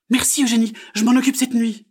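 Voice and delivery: high, loud frog voice; eager little frog. Acted. Frog thanks magpie, high eager voice.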